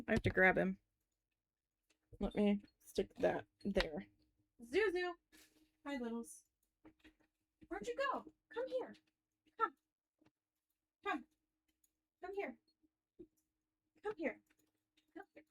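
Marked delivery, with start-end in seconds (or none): 4.7-15.4 much higher, much softer coaxing voice; pet talk. Real, not acted. Calling her dog Zuzu over, high soft coaxing.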